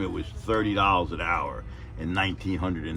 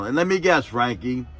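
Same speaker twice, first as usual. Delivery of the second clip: much higher, loud public telling-off; mock scolding boss; 6 semitones higher, 4 dB louder than usual. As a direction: roast him in front of everyone. Boss guessing, mocking.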